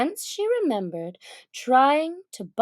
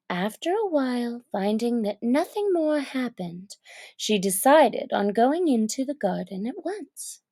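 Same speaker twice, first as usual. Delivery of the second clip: ordinary delivery, nothing marked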